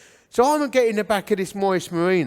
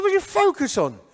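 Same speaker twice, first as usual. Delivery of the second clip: puzzled questioning; 10 semitones higher than usual. Retold or imitated. Teenager questioning the group's name.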